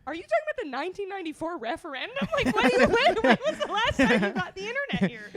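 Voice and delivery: high, loud, laughing retort; laughing disbelief. Retold or imitated. Daughter laughs at the host's stale politics, loud and high. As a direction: laugh through the comeback. Mocking Nigel's outdated remark.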